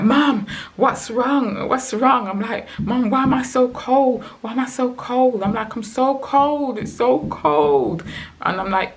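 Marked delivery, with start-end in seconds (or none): 0.0-2.3 high, drawn-out retold plea; distressed crying plea. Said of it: "crying and just shaking". 2.8-5.4 high-pitched retold wail to mum; distressed crying plea. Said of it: "crying and just shaking". 5.7-8.0 slow, high retold wail; crying complaint. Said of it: "crying and just shaking".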